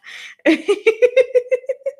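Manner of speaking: squealing high laugh. Real, not acted. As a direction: let out a delighted squeal of laughter. Laughing after cough joke.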